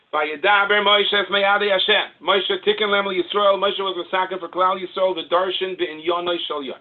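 loud, high declamation of holy text; declaimed text reading. Acted. Rabbi reading Sfas Emes to listeners, loud high declamation.

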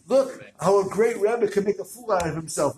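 high, loud announcement voice; loud proclaiming. Acted. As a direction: proclaim it like a headline. Ad headline proclaimed.

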